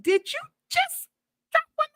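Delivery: very high, squeaky disbelief; freaking out about the bug bomb; shrill disbelief. Retold or imitated. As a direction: voice shooting up in shock.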